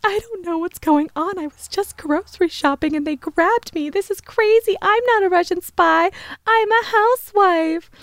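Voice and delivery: spy pretending to be a housewife, pleading with the detective in a high voice; fake-crying housewife. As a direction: play the innocent sobbing housewife, high and breathless. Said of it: "crying in the interrogation room".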